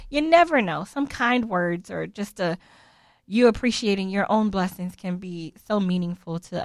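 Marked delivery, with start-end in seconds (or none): none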